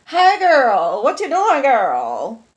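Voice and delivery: put-on high, loud sassy girlfriend call; playful friend voice. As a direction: gossip like an excited best friend. Acts out phoning a friend.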